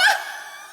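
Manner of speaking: high shrieking witch cackle. A narrator's reading. Wicked witches cackling at the stranger at their door, shrill cackle.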